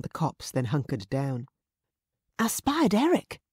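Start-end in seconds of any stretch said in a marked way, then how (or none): none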